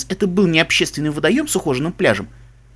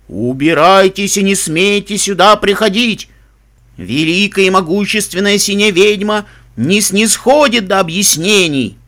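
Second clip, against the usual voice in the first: slow, loud, ominous spirit voice; booming echoing voice; 4 semitones higher, 5 dB louder than usual. Acted. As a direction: boom it out like an unseen witch. Hidden witch threatening visitors. (гулкий зловещий голос отовсюду)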